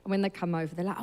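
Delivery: ordinary talk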